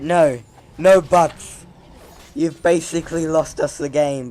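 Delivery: imagined scolding, loud and emphatic; stern telling-off. Retold or imitated. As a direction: lay down the law, loudly. Imagined telling-off of teammate, held back.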